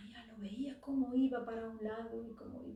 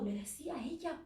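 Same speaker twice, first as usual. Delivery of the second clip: ordinary delivery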